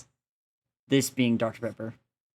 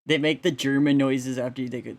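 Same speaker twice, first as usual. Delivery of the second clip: ordinary talk, nothing marked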